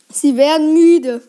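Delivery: ordinary delivery, nothing marked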